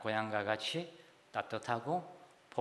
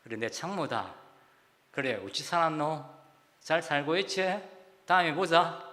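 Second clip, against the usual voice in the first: Gyeongsang dialect. Retold or imitated. Friend recognising [name] on the phone, hearty Gyeongsang dialect. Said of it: "그 친구가 누구인지 알고".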